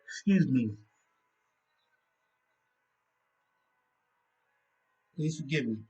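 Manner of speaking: talking through a yawn; sleepy yawning words. Real, not acted. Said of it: "I'm yawning".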